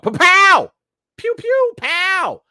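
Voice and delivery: high, loud shouted pew-pew gunfire; mouth gunshot noises. Retold or imitated. Vlogger acts out Sonya shooting the hostage taker, shouted gun noises. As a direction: blast out cartoon gun sounds.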